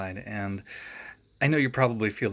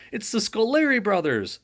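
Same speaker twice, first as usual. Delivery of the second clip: high, loud frightened scream; 9 semitones higher, 5 dB louder than usual. A narrator's reading. Judge screaming as ghosts pop out of the jar. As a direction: shriek the name in terror. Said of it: "screamed the judge".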